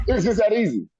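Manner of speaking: high laughing burst into the words. Real, not acted. Telling the interviewer about the call, laughing high. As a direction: crack up while saying it.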